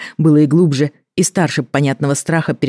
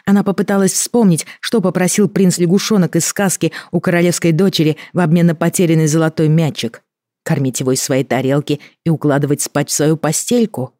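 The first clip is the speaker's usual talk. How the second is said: usual speaking voice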